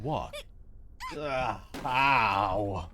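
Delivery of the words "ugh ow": pained cry. Acted. Hurt ankle, crying out in pain.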